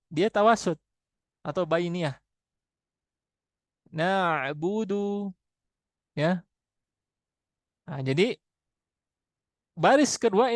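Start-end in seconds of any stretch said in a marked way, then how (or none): 3.9-5.4 very slow Na'budu; slow recitation. Shown as a demonstration. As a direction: recite Na'budu slowly, ain medium. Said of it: "itu ngalir". (Na'budu dibaca sangat pelan)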